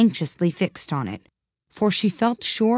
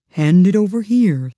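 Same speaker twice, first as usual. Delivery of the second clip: loud, grand dodo; 2 semitones higher, 6 dB louder than usual. A narrator's reading. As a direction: demand it grandly. Dodo demanding the thimble.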